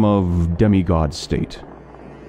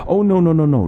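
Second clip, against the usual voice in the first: hasty reassurance; 9 semitones higher, 4 dB louder, faster than usual. Acted. Scholar hastily reassures amnesiac listener.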